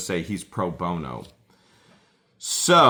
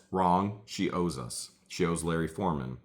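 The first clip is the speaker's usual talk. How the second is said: ordinary talk, nothing marked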